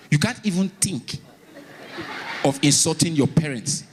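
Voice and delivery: ordinary delivery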